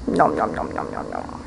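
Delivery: low "nom nom" gobbling sounds; playful munching noises. Pretend.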